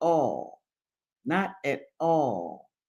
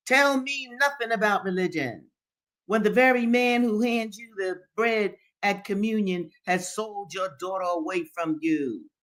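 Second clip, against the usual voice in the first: raised, forceful reproach; rebuking; 6 semitones higher, 3 dB louder than usual. A narrator's reading. Henry rebukes the old man over religion, rebuking. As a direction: push back hard in a higher voice, settling at the end. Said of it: "replied Henry rebukingly".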